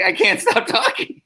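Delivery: very high-pitched helpless squeal; mock panic. Extra confessing to his neighbour, high comic panic. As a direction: blurt helplessly at the top of your pitch.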